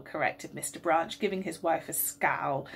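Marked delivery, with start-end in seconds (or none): none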